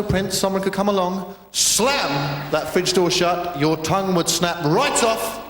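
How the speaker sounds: no no no, stop him at the fridge; alarmed parent scolding a misbehaving guest; scolding Prince. Acted.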